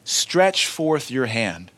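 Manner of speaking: slow, drawn-out command, each word weighed. Acted. Preacher repeating Jesus' words to his congregation, slow and solemn. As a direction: lay out the command slowly and solemnly. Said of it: "Notice the commandment".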